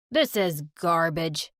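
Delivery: a slow, low fed-up father; disgusted dad. Acted. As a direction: spit it out in contempt.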